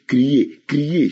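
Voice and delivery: ordinary talk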